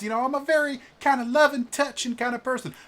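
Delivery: high, loud, fast and bubbly; gushing noblewoman. Acted. A noble wife cooing over the adventurers, high and bubbly. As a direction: gush over everyone like a doting society lady.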